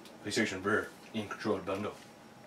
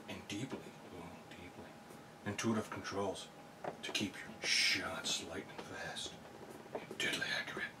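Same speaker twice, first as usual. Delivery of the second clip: mock sultry whisper; 3 semitones lower, 8 dB quieter than usual. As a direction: whisper the product blurb like a seduction. Mock sultry reading of ad copy.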